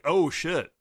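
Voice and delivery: mock realization. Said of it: "registering to Luffy".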